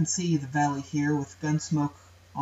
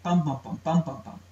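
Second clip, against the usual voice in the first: louder galloping beats; dactylic dimeter rhythm; 4 dB louder than usual. Shown as a demonstration. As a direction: chant it like two dactyls, DUM-da-da DUM-da-da. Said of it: "has a pattern of".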